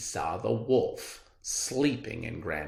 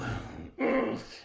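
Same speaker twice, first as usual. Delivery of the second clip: sly chuckle; 3 semitones lower than usual. Acted. Wolf chuckles after plotting.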